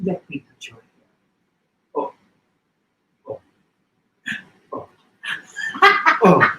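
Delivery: lightly voiced aunt, purring over hair; Aunt Voula quote. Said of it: "one is".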